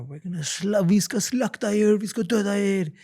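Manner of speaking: high, loud mock threats; imitated threatening yell. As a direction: imitate an attacker yelling through a door. Imitating attackers banging on door.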